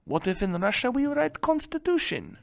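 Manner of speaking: put-on hushed, high-pitched Russian accent; quietly scheme in a mock Russian accent. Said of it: "You guys like my Russian accent?".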